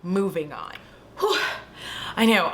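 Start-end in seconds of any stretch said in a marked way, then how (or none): 1.2-1.4 voiceless puff of breath; winded whew. Real, not acted. Sweaty storyteller catches breath, a winded whew. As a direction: blow out a short breath of exertion.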